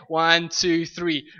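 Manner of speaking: normal talking voice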